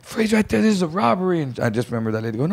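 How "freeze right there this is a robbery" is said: much higher, forceful order; robbery command. Retold or imitated. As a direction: announce the holdup with authority. Gunman announcing robbery.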